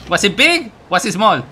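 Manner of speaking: ordinary talk, nothing marked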